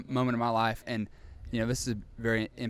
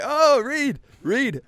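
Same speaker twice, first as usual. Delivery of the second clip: much higher, loud mocking "Oh, read, read"; taunting classmates; 12 semitones higher, 8 dB louder, much slower than usual. Classmates in the corner goading him, high mocking taunt. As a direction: goad him in a sneering singsong. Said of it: "douchebags in the corner, like".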